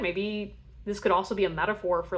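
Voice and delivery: ordinary talk, nothing marked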